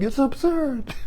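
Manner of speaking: said through a soft laugh; softly amused. Real, not acted. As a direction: laugh as you say It's absurd.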